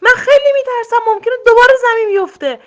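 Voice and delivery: very high, loud, fast shriek; terrified squealing. A narrator's reading. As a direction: squeal in terror to refuse. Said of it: "خرگوش جیغ و ویغ کرد".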